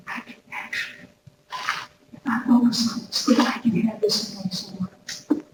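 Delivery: almost whisper it, moved to tears; hushed tearful hope. Retold or imitated. Writer at three a.m., whispering in tears of joy.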